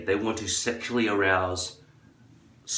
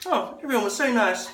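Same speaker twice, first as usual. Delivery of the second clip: much higher, airy, hammed-up girly voice; mock happy girl; 4 dB louder than usual. Women recall their night in a much higher chirpy mock voice. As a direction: chirp it sweetly and cluelessly. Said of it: "the women are like".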